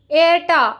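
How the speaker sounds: cheeky sister's complaint; mischievous protest. A narrator's reading. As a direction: pout his name in protest. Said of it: "അവൾ കുറുമ്പോടെ അവനെ വിളിച്ചു".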